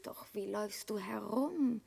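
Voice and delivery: soft, conspiratorial whisper; mocking his rags in a whisper. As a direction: lean in and breathe it. Troll girl teasing Peer's rags.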